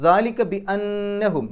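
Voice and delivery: slow recitation, ringing nasal on the noon of അന്നഹും; slow recited ദാലിക ബി അന്നഹും, noon nasalised; nasal ghunna on noon. Shown as a demonstration. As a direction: hum the n of അന്നഹും. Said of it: "നൂനിൻ്റെ ശബ്ദ് മണിക്കണം".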